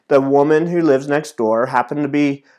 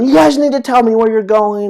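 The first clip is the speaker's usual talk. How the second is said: louder, higher scolding yell at his daughters; retold yell; 8 semitones higher, 4 dB louder than usual. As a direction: snap at the kids, loud and high after the scare. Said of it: "I yelled at 'em".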